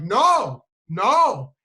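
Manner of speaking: loud, high retold wail. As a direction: shout it like an upset kid. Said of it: "getting louder and more emotion".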